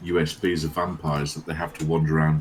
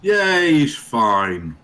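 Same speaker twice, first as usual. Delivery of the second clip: excited cheer; 7 semitones higher, 7 dB louder, much slower than usual. Real, not acted. Cheering as a character returns.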